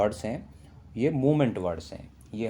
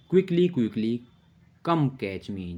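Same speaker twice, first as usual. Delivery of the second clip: lively poem recitation; 3 semitones higher, 4 dB louder, much slower than usual. Shown as a demonstration. Teacher recites the chase line of the poem brightly to children. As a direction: chant it playfully, like a chase game. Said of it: "poem है".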